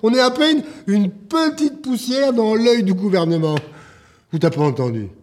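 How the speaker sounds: exasperated tirade building to high cries; angry shouting. Acted. Man berating club owner over cancelled contracts, shouting angrily. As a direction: shout out your despair at being ignored. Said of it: "Arrête de crier!".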